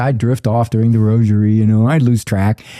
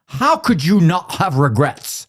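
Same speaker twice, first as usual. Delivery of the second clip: mildly indignant, drawn-out, higher-pitched question; mad, retold; 6 semitones higher, slower than usual. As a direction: mimic a friend's slow, put-out disbelief. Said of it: "something that made him mad".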